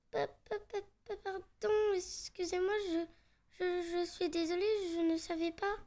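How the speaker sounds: bégaiement apeuré, tout bas; terrifiée. Acted. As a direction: bafouiller ses excuses, effrayée. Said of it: "terrifiée par ces cris stridents". (voix tremblante de peur)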